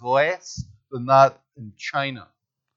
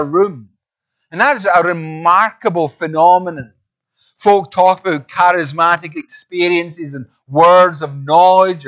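raised, emphatic sermon delivery; higher, louder pulpit voice; preaching; 4 semitones higher, 7 dB louder than usual. Real, not acted. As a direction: preach it out with forceful stresses.

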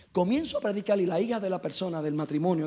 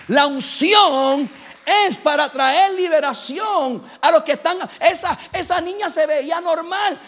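loud, high, drawn-out proclamation; full-force preaching; 8 semitones higher, 10 dB louder, slower than usual. Acted. Preacher proclaims what anointing is for, shouted preaching. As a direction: cry it out to the whole congregation.

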